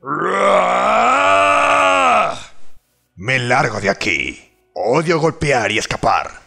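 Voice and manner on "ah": high, strained war shout; battle cry. Acted. Warrior roaring his spell name in battle, fierce high shout. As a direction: bellow the ability name high and loud. Battle cries casting abilities.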